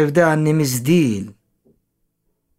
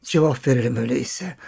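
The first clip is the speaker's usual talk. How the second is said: usual speaking voice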